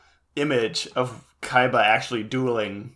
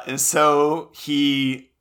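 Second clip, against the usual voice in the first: ordinary talk, nothing marked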